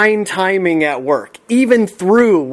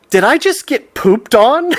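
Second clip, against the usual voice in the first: surprised; 7 semitones higher than usual. Retold or imitated. Realizing a bird pooped on him.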